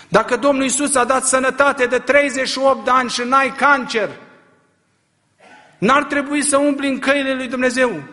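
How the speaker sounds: high, loud rising sermon cry; preacher driving home a rebuke; fiery preaching. Real, not acted. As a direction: press the question hard on the crowd. Preaching at full force.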